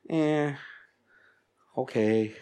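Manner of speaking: half-hearted, noncommittal answer; hesitant lukewarm reply. Retold or imitated. Someone asked how they are, hesitant lukewarm reply. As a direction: answer reluctantly, with little voice. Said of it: "they pause and they go".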